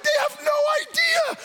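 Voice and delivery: yard-sale bargain hunter hollering with joy; giddy excitement. Pretend. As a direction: shout like you got away with a steal. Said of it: "You're like".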